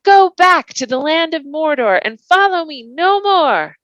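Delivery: high, loud defiant cry that strains; a frightened hobbit shouting high at the riders; thin shrill cry. Acted. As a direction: defy the riders though the voice strains. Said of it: "His voice sounded thin and shrill".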